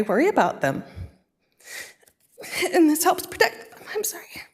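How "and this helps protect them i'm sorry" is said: breaking into a tearful whisper; words catch in the throat and fade away; choked up. Real, not acted. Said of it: "I get emotional because".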